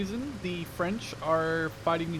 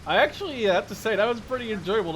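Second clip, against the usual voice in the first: excited; 4 semitones higher, 7 dB louder than usual. Real, not acted. Cheering after a kill.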